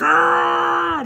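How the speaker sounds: a sudden high, loud growling roar; mock roar. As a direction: imitate a roid-raging cop's snarl. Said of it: "like this".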